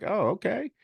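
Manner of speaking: high-pitched mock surprise; squeaky little reaction of surprise; pleasantly taken aback. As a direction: pitch leaps up, reacting to unexpected backup. Acts out his reaction to agreement.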